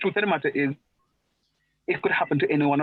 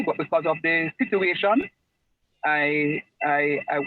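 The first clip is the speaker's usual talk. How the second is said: normal talking voice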